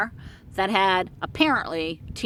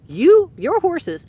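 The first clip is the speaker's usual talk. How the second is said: higher, louder and slow, losing patience; frustrated outburst; 8 semitones higher, 7 dB louder, slower than usual. Retold or imitated. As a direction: snap at her in mock frustration, drawn out. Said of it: "I started getting frustrated with her".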